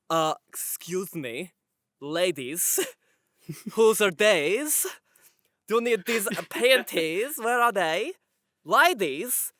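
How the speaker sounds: slow, drawn-out mock suave voice; hammed-up smooth operator; Chad. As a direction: stretch each phrase like a cocky ladies' man. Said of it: "like, a Chad".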